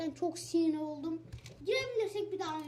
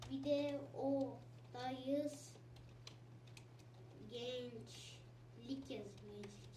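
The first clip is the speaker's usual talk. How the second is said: slow drawl; 4 semitones lower, 8 dB quieter, much slower than usual. Real, not acted. Drawn-out mumbled aside.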